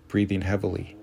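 ordinary delivery, nothing marked

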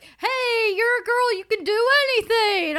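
high, loud fake pep talk; sing-out high mock encouragement; mock girl-power cheer. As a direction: cheer them on with hammed-up condescension. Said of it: "it's like".

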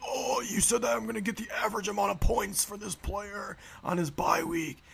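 high, whiny, cracking mock outrage; high squealing complaint, mocked; mock freak-out. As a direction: imitate a grown man throwing a tantrum. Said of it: "He completely freaked out".

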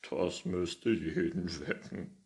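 slow, amused words with a chuckle in them; old man chuckling at his own joke. Acted. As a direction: say it while still chuckling. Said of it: "sagte der Alte kichernd".

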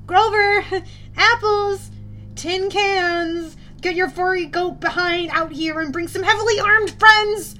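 high, loud frantic calling; frantic projected thoughts. A narrator's reading. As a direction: call out like crazy for attention. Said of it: "projected my thoughts like crazy".

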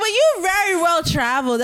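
high-pitched, emphatic insistence; mock insistence. Friend insistently repeats her own compliment.

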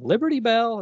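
high, loud call of a name; acted yell. Retelling characters coming in the door, yelling. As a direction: call it out high and loud, acting a comic scene. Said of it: "like, yelling".

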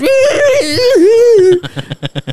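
high, loud comic screech; high-pitched squealing scream, hammed up; mock flailing scream. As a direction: imitate a wild screaming fit. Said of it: "He just goes".

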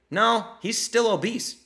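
high, loud, slow contradiction; emphatic acted-out correction; exasperated retort. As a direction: push back incredulously, stretching the words.

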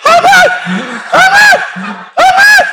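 very high shrieking amen; shouted amen. Retold or imitated. New churchgoer erupting in church, high shout. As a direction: scream it out in ecstasy.

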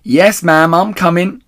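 loud, high obedient reply; calling up. Acted. The big woman calls up obediently, loud and high. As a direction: answer up the stairs. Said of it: "said the big woman".

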